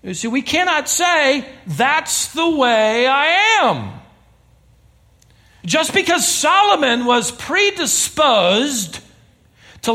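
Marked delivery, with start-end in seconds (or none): none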